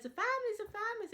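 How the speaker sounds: excited squeal. Retold or imitated. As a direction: squeal it like the best news ever. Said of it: "And then Demi says".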